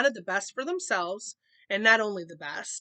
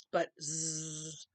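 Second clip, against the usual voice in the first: soft sleepy zzz snore; 4 semitones lower, 8 dB quieter than usual. Acted. Papa asleep when Mama asks, soft snore. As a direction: drift off with a quiet snore. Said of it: "was Papa's only suggestion".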